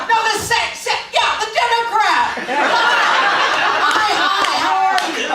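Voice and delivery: high, loud phone shouting, laughing through it; laughing mock yell on a phone call. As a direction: bellow into the receiver for a deaf mother. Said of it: "you would be yelling the whole time".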